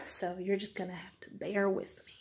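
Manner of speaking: whispered aside to the viewers. Real, not acted. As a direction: drop to a breathy whisper.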